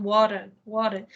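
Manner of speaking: water with the American flapped T; American "water", T as a quick tap; American accent. Shown as a demonstration. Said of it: "sotaque americano".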